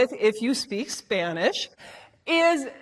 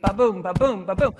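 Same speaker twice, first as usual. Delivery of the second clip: "ba-boom" thumped out, BOOM stressed; steady pulse on "ba-boom", weak-STRONG; low "ba-boom" like a beating heart; heartbeat rhythm; 5 semitones lower than usual. Shown as a demonstration. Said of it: "it's our heartbeat".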